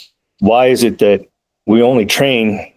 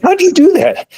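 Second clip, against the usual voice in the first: much higher, wondering question; amazed lieutenant. Retold or imitated. Lieutenant asking the trainer how he did it, astonished and much higher. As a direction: ask as if genuinely astonished.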